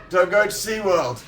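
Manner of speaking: high-pitched, hammed-up stage shout. Singer warning a concert crowd, mock rallying call. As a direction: badly imitate a pop star addressing fans. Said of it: "that's my terrible Harry impression".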